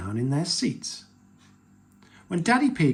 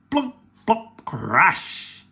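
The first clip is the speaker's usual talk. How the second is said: punchy popping words, then a big crash; loud, playful onomatopoeia; acted sound effects; 6 semitones higher, 7 dB louder, much slower than usual. A narrator's reading. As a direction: make each word sound like falling groceries. Sound effects of falling shopping.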